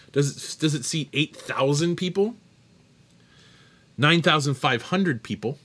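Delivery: normal talking voice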